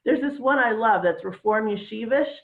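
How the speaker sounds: ordinary delivery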